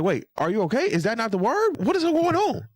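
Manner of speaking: imitating baffled questions, pitch climbing high; puzzled disbelief. Acting out Mark's puzzled reaction.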